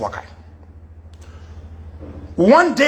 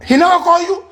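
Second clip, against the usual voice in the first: surprised question; 8 semitones higher, 5 dB louder, faster than usual. Retold or imitated. Retold disbelief at Stanton's news.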